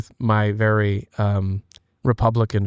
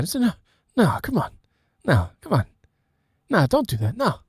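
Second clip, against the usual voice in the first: high rambling Biden voice, "come on, Jack"; Biden impression; 10 semitones higher than usual. Biden voice continuing the son of God joke, high. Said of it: "in a Biden voice".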